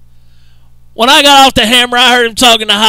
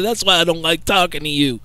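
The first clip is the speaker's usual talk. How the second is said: ordinary delivery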